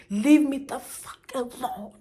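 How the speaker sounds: slow, high, loud scream of leave me alone; furious high yell, every word dragged out; screamed at him. Retold or imitated. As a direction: yell it slowly at the man who keeps touching her. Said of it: "And I screamed".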